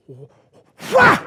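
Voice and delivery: explosive burst of breath, loud and high; sharp exhale shout. Shown as a demonstration. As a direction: punch the air out with a yell. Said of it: "he would breathe out".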